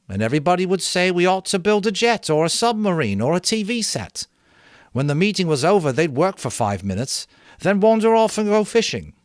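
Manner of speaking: high, loud mocking of meetings; sarcastic boy. A narrator's reading. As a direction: sneer at endless talk. Ralph sarcastic about meetings.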